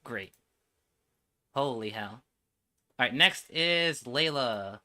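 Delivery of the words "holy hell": ordinary talk, nothing marked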